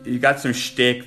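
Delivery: usual speaking voice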